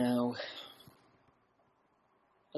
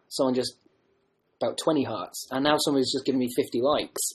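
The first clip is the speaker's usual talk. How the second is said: ordinary talk, nothing marked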